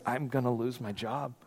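hushed, defeated retelling of despair; low-volume, understated anguish; distraught. Retold or imitated. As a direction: imitate a distraught man, very softly. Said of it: "he was so distraught".

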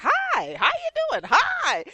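mock gushing greeting, very high-pitched; Linda. Imitating a friend who greets everyone everywhere, high and gushing. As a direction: sing out an over-friendly hello. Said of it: "Linda is. Everywhere we go, she's like".

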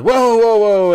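loud, high shocked crowd; alarmed whoa. Retold or imitated. Passengers gasp at the slur, loud and high. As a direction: shout it out to stop her. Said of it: "And everyone's".

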